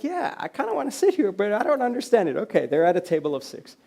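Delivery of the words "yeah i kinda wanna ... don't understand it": high-pitched, hesitant newcomer; mock bewilderment, voice lifted high; confused. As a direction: imitate a puzzled guest in a raised pitch. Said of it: "Very confused by it".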